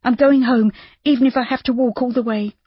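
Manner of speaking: Judy, desperate. A narrator's reading. Judy telling Rob she will walk home, desperate and high. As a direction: panic pushing her to the door. Judy grabbing cape to leave.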